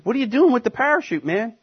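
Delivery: high, fast, scoffing jeer; mocking scoffer. Scoffer jeering at a man clutching a parachute, mocking. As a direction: tease him like he's lost it. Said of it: "If some people were laughing at you, saying".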